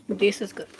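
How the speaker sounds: savoring while chewing; mouth full. Real, not acted. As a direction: speak with chocolate in mouth.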